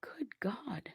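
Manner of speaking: breathless stunned whisper. Real, not acted. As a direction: whisper it in shock. Stunned at snake count.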